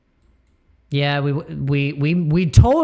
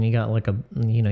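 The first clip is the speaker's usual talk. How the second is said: ordinary talk, nothing marked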